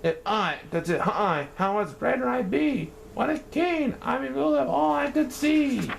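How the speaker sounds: high, grand boasting; dreaming king. Acted. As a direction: savour your own greatness.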